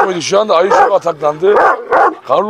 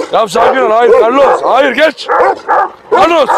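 loud, high, urgent orders; urgent command; 6 semitones higher, 4 dB louder than usual. Real, not acted. Owner ordering Carlos to back off, urgent command. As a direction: call the animal off sharply.

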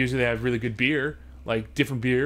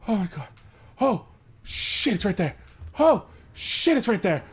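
sharp frightened cries, much higher and louder; panicked fear; 8 semitones higher, 4 dB louder than usual. Real, not acted. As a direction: yelp it out in real panic. Alien right beside the player.

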